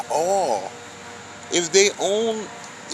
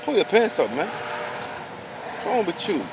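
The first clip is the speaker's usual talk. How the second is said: put-on higher, scolding young men on the street; half-playful reprimand; mock scolding; 3 semitones higher, slower than usual. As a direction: tell them off like an elder. Scolding boys acting a fool.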